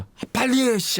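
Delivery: high, exasperated hurry-up; mock impatience. Companion pressing the stalling cop to count, impatient. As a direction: retell someone fed up with stalling.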